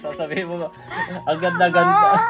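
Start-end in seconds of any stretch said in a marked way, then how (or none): 1.2-2.2 high, loud, laughing imitation; laughing mock quote. Husband imitates his wife laughing at her video later, laughing mock quote. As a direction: imitate her future self, cracking up. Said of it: "tatawanan mo lang to".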